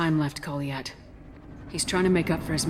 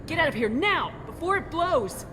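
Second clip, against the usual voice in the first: faked alarm; 11 semitones higher than usual. Shepard fakes alarm to scare guard.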